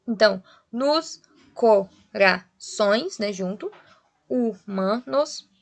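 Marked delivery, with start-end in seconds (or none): 0.7-3.2 "nos co-ra-ções" in separate beats, very slow; syllable by syllable. Shown as a demonstration. Teacher dividing poetic syllables, slow separated beats. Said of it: "em termos poéticos, seria a mesma sílaba". 4.3-5.5 "humanos" slowly as hu-ma-nos; syllable by syllable. Shown as a demonstration. Teacher dividing the verse, slow separated syllables. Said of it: "em termos poéticos".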